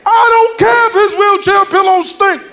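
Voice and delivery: put-on grandma defending her baby, gospel style. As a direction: belt it like a gospel singer. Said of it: "She said".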